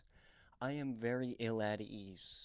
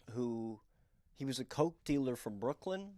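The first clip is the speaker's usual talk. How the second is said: ordinary talk, nothing marked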